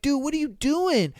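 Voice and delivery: put-on a friend startled at the drunk guy; worried friend. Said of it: "He's like".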